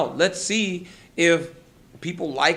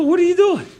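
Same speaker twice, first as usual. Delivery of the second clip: high, loud incredulous cry; retold exasperated shout; 9 dB louder than usual. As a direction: yell it at an out-of-control teammate. Retelling shouting at raging fighter.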